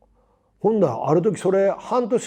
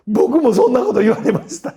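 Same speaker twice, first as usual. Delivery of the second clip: high, loud imitation of a sheepish junior; junior's rueful reply; 8 semitones higher, 8 dB louder than usual. Junior actor admits the same to his senior, raised high and loud.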